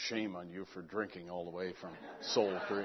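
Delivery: soft, low, chuckling through a mock reproach; laughing mock scolding. Retelling what strangers must think, laughing softly. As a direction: tease yourself through a laugh.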